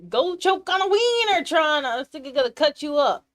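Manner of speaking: high, loud outburst; hammed-up loud ranting; mock threat. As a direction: yell the insult in exasperation.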